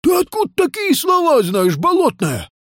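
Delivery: high-pitched incredulous question; startled, high questioning voice; astonished. Acted. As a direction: demand to know, amazed. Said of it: "удивился Нил Нилыч".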